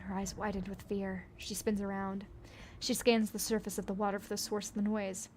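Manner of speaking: ordinary delivery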